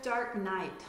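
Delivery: ordinary delivery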